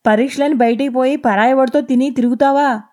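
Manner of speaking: put-on a drunk husband picking a fight, loud and high; angry drunk husband accusing; drunken accusation. A narrator's reading. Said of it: "అన్నాడు".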